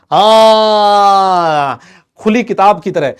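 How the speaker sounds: mock molecule voice. Teacher voicing H2O2 molecule.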